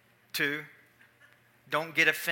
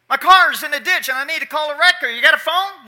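even louder shout, high, rushed; repeated yell; 9 semitones higher, 12 dB louder than usual. Retold or imitated. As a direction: shout it again so he finally hears. Repeats shouting to deaf farmer.

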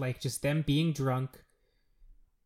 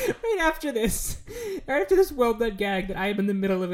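higher, breaking up with laughter; real laughing; 9 semitones higher, 4 dB louder than usual. Real, not acted. Cohost cracking up while recapping a gag, laughing through the words.

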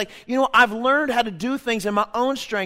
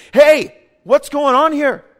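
loud, slow, higher fatherly challenge; confronting father; 6 semitones higher, 9 dB louder, slower than usual. Retold or imitated. Spiritual father confronting a son about his life, loud. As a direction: call someone out like a concerned father. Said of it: "real fathers in the faith who can look at your life and say".

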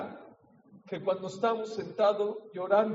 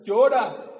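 gentle permission to cry; 10 dB louder than usual. Retold or imitated. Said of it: "Y le dijo, mira".

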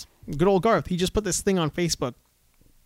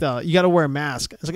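usual speaking voice

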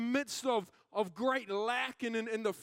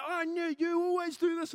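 raised, high-pitched mock accusation; accusing attitude; 6 semitones higher, 4 dB louder than usual. Arguer to partner with an attitude, high mock accusation. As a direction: go at them as if mid-argument, pitched up. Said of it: "you go on with an attitude".

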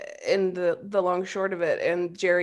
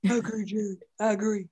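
soft, slack-jawed dopey dog; lightly voiced big dumb dog; 6 dB quieter, faster than usual. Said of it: "I just love that voice".

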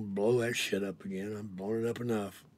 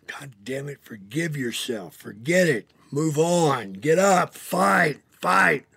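building from a whisper to a loud, higher yell; retold yelling; 4 semitones higher, 11 dB louder, slower than usual. Retelling how Dallas yelled at him to fight on, building to a shout. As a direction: start hushed then shout him up to fight. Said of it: "Dallas would be yelling at me".

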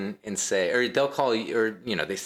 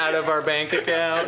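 mock crying scammer; 6 semitones higher than usual.

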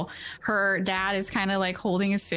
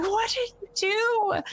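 high-pitched, drawn-out outburst at normal volume; mock yelling, pitched way up; acted teenage yell. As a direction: yell it high and slow without full force. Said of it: "she's, like, yelling at him".

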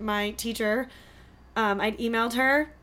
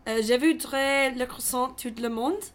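speaking French on request; careful French pronunciation; French accent. Shown as a demonstration. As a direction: say it in hesitant French. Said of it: "Say, ... something in French".

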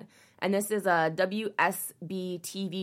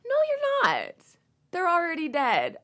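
incredulous retort; 8 semitones higher than usual. Real, not acted. Scoffing at the reporter's claim to protect identities, high retort. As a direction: snap back at the excuse. Mocking article's excuse.

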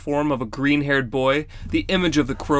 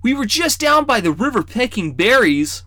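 put-on boy explaining; 9 semitones higher, 5 dB louder than usual. A narrator's reading. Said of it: "Rawl explained".

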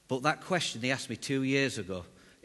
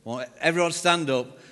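ordinary talk, nothing marked

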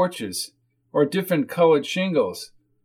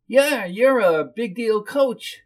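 high, slow whiny woman; whiny character voice, pitched up; whiny hostess; 7 semitones higher, slower than usual. Acted. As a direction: draw it out in a high whine.

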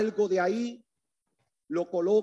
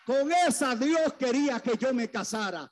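high-pitched, driving sermon climax; impassioned preaching; 6 semitones higher than usual. Real, not acted. Preacher proclaims the church of Christ to the congregation, impassioned preaching. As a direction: build a relentless list, pitched high and urgent.

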